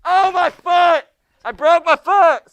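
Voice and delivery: high, loud and slow mock wail; hammed-up howl over a broken foot; stretched-out loud fake cry; mock scream of pain. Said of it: "you're screaming".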